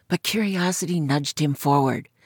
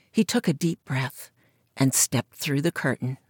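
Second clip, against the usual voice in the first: plain reading voice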